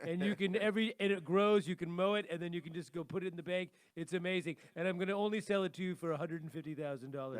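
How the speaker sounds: boastful huckster pitch with loud bursts; hard-sell the ridiculous deal; toupee-wearing salesman. Acted. Co-host playing a toupee-wearing dealmaker selling gold grass, boastful salesman. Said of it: "put a toupee on my head, and I'll be like".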